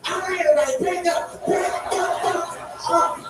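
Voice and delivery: high commanding call to back up; crowd control. Retold or imitated.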